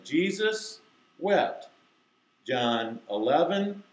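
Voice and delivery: stretched-out verse recitation; slow, deliberate. Shown as a demonstration. Teacher leading kids reading together, slow and deliberate. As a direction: lead the group slowly, word by word. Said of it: "I have to say it slowly, deliberately".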